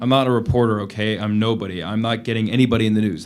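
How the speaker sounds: loud, pressing denial; insistent protest. Acted. Prisoner loudly insisting to the lieutenant he is nobody. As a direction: push back hard, voice rising.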